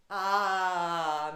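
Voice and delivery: normal talking voice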